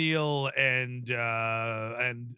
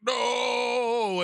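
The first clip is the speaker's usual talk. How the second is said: long, high drawn-out mock cry; retold cry of no; 7 semitones higher than usual. Fishburne faces the horrors of hell, mock anguished cry. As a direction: imitate a movie hero's stretched-out no. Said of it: "Fishburne's like".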